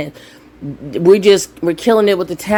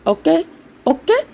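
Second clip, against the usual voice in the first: much higher, teasing squeaky okays; sassy high "okay"; 9 semitones higher than usual. Real, not acted. Telling viewers she's made her point, sassy and high.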